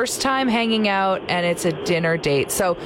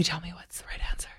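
hushed conspiratorial whisper; 3 semitones lower, 12 dB quieter, much faster than usual. Pretend. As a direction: whisper it like a secret. Joking request for answer.

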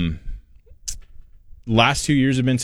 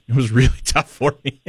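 laughing through the words; 6 semitones higher than usual. Real, not acted. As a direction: chuckle while admitting it was hard. Laughs at the hard hike.

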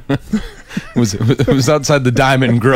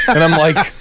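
ordinary talk

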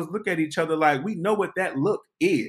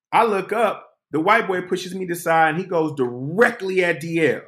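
ordinary talk, nothing marked

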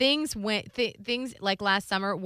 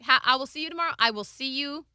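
cheery shooing; 6 semitones higher than usual. Retold or imitated. Daughter shooing mother to bed.

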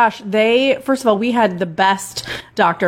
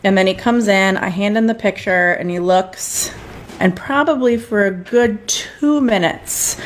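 ordinary talk, nothing marked

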